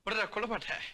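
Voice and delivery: usual speaking voice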